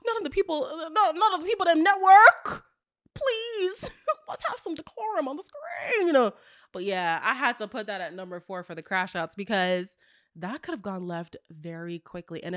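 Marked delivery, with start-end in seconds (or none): 0.1-6.3 high, prim plea for decorum; mock prim plea. Speaker begging network for decorum, high and prim. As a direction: beg for decorum in a high, fussy voice. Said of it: "I said".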